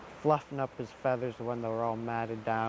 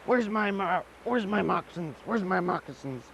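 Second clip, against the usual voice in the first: high, impatient demanding; rushing to get going; hurried asking; 7 semitones higher than usual. Acted. Sagani hunting for his moccasins, high impatient asking.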